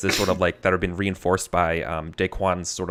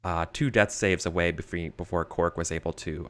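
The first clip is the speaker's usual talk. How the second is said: story narration; 6 dB quieter than usual. A narrator's reading. GM narrates the flashback scene.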